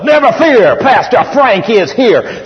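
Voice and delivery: high, loud mock-heroic shout; proclaimed loud catchphrase. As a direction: announce yourself to the whole airport. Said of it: "Says that for everybody at the airport".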